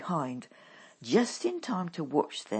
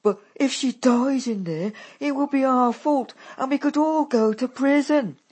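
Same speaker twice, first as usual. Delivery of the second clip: tearfully; 9 semitones higher, 10 dB louder than usual. A narrator's reading. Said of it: "Daisy says tearfully".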